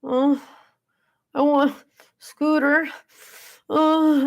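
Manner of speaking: put-on small, high toddler voice with halting words; toddler holding back tears. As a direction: imitate a little one about to cry. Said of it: "trying so hard not to cry".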